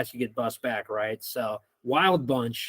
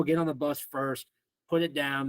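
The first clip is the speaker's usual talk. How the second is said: ordinary delivery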